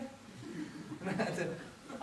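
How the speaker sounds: breathy laugh. Real, not acted. Laughs after own impression.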